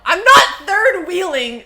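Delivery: loud, high, drawn-out protest; indignant denial. Real, not acted. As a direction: yell back at teasing viewers, slowly stressed. Denies chat's teasing.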